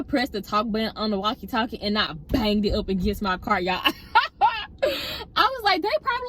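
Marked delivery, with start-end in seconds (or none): 3.8-4.8 high, bright burst of laughter; laughing at herself for banging the walkie. Real, not acted. Laughs to her viewers about banging the walkie, high burst of laughter. 4.8-6.3 words squeezed out through laughter, much higher; giggling through the setup; laughing while talking. Real, not acted. Tells viewers what coworkers thought, laughing through it. Still laughing mid story.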